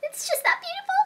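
high swooning gush; high breathy rapture. Acted. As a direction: melt over its beauty. Rapture over perfume.

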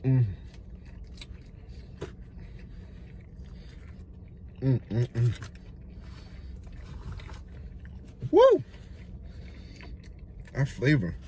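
mumbling through chewing; chewing while talking. Real, not acted. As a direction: mouth full of food. Seen 2: Bites at -5; closed mouth moving until about 7.8, then wipes mouth.

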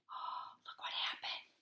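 soft hushed aside; whisper. Real, not acted. Reader whispers to the child, hushed.

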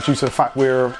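normal talking voice

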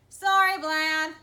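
high, loud shout after someone; shouted apology; calling out. A narrator's reading. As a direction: call it across the room, high and loud. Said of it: "I called".

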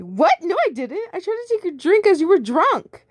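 frantic, high denial; high panicked protest. Acted. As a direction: deny it frantically. Protagonist denies.

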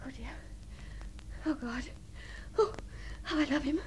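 hushed, lovesick murmur to oneself; quiet private swooning; lovelorn sighing. Acted. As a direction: sigh it softly, with gaps between phrases. Alone, sighing over her lover.

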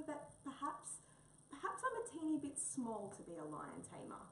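high, hesitant little voice; nervous. Acted. Mouse asks Wombat about lion taming, nervous and high. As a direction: ask timidly, unsure of yourself. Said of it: "said Mouse nervously".

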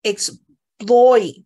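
teacher slowly stressing exploit; slow loud model. Shown as a demonstration. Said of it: "注意，exploit".

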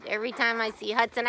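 ordinary delivery

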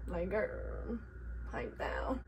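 ordinary delivery

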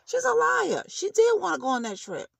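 sudden high, loud cry of she's a liar; outraged outburst. Real, not acted. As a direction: blurt it out, fed up and outraged. Angry outburst at Tammy.

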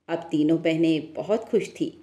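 usual speaking voice

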